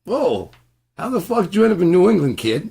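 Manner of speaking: high, loud, fast amazed exclamation; surprised local. Retold or imitated. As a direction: act a stunned local. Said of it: "the guy's like".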